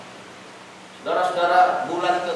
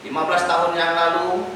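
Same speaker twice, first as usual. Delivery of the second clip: ordinary talk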